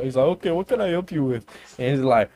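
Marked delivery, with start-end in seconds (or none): 0.2-1.4 higher, polite call-center voice; support agent voice. Retold or imitated. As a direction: play a chipper help desk agent.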